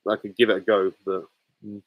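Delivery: ordinary talk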